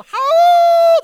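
a long, very high, loud wolf howl. Retold or imitated. Pastor imitating a wolf to his church, loud high howl. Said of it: "when they hear wolves go".

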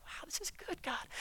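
whispered high and soft in wonder; a hushed amazed exclamation; breathless awe. Retold or imitated. A believer relieved by God's help, in a hushed high whisper. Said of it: "And you're like".

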